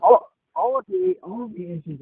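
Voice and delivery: sounds muffled, like into a pillow; muffled phone line. Real, not acted. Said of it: "I don't know if you're talking through a pillow or on one".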